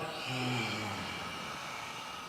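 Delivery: slow deep breath out; audible breath out; long exhale. Shown as a demonstration. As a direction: let the breath go with a sigh. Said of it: "And then let it out".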